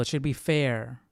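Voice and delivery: usual speaking voice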